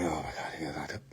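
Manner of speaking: very low, very soft aside; hushed dismay. Retold or imitated. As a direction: mutter it to yourself, acting it out. Acts out reaction to trouble.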